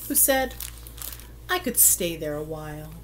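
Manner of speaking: ordinary delivery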